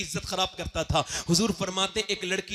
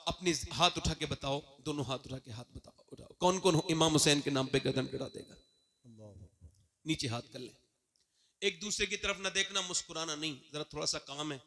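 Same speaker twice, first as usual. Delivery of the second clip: softer, lower, intimate call to raise hands; quiet instruction; 5 semitones lower, 8 dB quieter than usual. Real, not acted. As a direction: hush the crowd and guide them. (धीमी, नरम आवाज़ में हिदायत)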